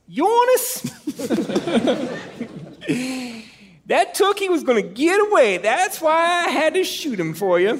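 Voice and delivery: high, hammed-up Maine accent; Scotty's Maine voice. Storyteller mimics his Maine guide Scotty, high Maine accent. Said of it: "without doing his voice".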